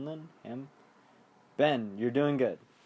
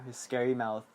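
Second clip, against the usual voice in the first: normal talking voice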